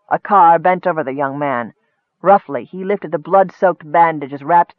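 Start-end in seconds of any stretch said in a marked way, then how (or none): none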